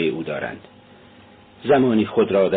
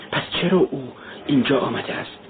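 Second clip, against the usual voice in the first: plain reading voice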